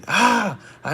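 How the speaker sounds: high, loud mimicked yell; high mock yell of a crazy man; wordless cry, imitating a shouter; imitated crazed shout. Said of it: "he's shouting, like, all type of stuff".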